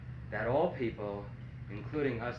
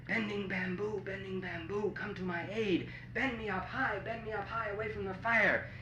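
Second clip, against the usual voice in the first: higher-pitched plea to a spirit; hushed urgent incantation; 7 semitones higher than usual. Acted. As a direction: chant it as a secret, high-pitched appeal. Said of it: "whispered very quietly".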